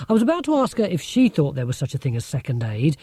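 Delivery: plain reading voice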